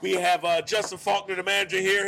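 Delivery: usual speaking voice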